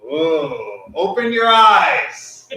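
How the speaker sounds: high, loud wide-eyed exclamation; dramatic improv. Pretend. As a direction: ham up a sudden revelation. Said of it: "Crazy wisdom".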